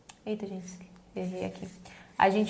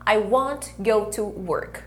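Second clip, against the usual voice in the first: slow drill repeat; much slower than usual. Shown as a demonstration. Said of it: "slower repeat for students".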